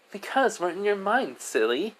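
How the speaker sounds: put-on high child voice, dropping at the end; high-pitched kid explaining; playful little girl. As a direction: tease gently as a small child. Child explains to her new friend.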